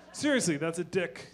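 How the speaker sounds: usual speaking voice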